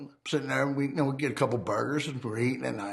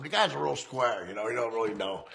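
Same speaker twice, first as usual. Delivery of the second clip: normal talking voice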